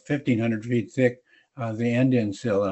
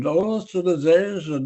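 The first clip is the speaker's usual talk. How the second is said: put-on Russian accent; 5 semitones higher than usual. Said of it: "do a Russian accent".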